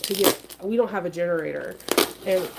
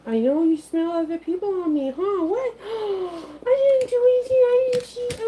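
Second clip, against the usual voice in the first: puppy voice; 10 semitones higher, 3 dB louder than usual. Real, not acted. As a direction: coo at the pet in a high voice. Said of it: "You guys are hearing my puppy voice".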